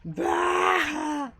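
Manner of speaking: loud mock "Bah!". Mock scoff at Magikarp.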